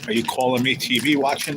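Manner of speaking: ordinary delivery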